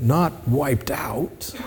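slow, breathy whisper, all voice dropped. Real, not acted. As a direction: let the words fade to a hushed whisper. Contrasting restoring with destroying.

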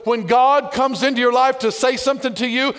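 normal talking voice

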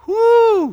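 loud, high preacher's whoop; excited whoop. Real, not acted. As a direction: let out a joyful whoop.

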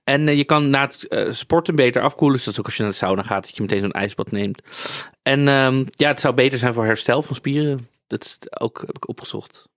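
usual speaking voice

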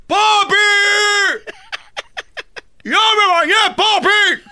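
hammed-up, very high-pitched yell; screaming child. Boy telling his dad he has bathed, comic screaming. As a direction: scream for papi at the top of your voice. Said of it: "le gritaba yo así".

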